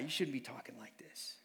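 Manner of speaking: hushed whisper; whispered scolding; whispered aside. Retold or imitated. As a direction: whisper it like a worried friend. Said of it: "he's like".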